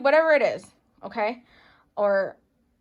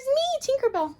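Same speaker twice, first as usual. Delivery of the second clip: squeal of delight. Real, not acted. Excited squeal at a cute figurine.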